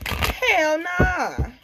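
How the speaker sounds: high, loud hell nah; outraged dismissal; emphatic refusal. Real, not acted. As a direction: shut it down flat out.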